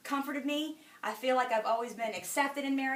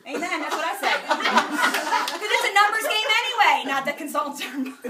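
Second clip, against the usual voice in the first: laughing; 4 semitones higher, 9 dB louder than usual. Real, not acted. Laughing while explaining.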